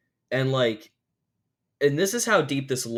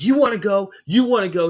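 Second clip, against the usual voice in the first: higher, louder mock challenge; imitating a woman spoiling for a fight; aggressive challenge; 8 semitones higher, 9 dB louder, faster than usual. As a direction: pick a fight with anyone near. Lady picking fights in restaurant.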